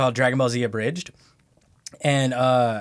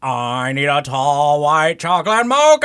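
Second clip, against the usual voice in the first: stupid character voice; 7 dB louder than usual. Retold or imitated. Ordering at the drive-thru, loud silly character voice. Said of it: "stupid frickin' voice".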